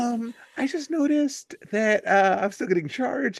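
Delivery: high, timid customer-service voice; meek polite voice. Retold or imitated. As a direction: ask sheepishly, far from yelling. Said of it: "I whispered at them".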